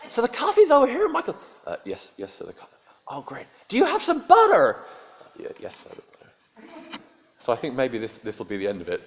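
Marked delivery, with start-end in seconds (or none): 0.1-1.4 very high, loud, gushing American voice; booming cheery tourist greeting the waiter. Retold or imitated. Tourist points out coffee. 1.7-3.5 quiet, hesitant, stammering reply; lightly voiced timid hotel waiter; nervous waiter. 3.7-4.8 very high, loud, gushing American voice; booming cheery tourist greeting the waiter. Retold or imitated. Tourist asks for butter. 5.4-7.0 timid whisper. Retold or imitated. Waiter answers.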